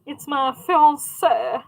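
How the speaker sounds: slow, drawn-out fancy French accent on fiance. Shown as a demonstration. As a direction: stretch fiance out in a posh French accent, falling. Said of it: "you have to say it with that accent".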